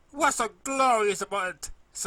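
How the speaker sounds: high, loud tearful protest; upset crying filly. A narrator's reading. Crying filly protesting to the cheerful pony, loud tearful wail.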